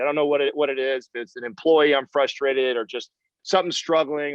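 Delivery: ordinary talk, nothing marked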